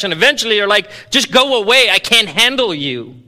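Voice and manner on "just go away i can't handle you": high, loud fed-up plea; exasperated dismissal. Retold or imitated. Worn-out listener telling noisy Christians to go away, loud and fed up. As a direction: push them away, worn out.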